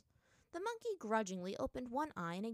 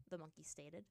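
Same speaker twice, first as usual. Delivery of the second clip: plain reading voice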